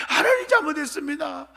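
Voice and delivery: high, pleading outcry; acted desperate repentance. Preacher plays sinner repenting in hellfire, desperate cry. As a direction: cry out for mercy in mock torment.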